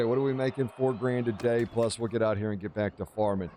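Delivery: ordinary delivery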